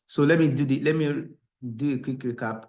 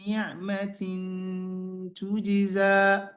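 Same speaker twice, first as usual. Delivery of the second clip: chanted, higher recitation with ghunna; Quran recitation; 5 semitones higher, faster than usual. Acted. Teacher chanting verses for the class.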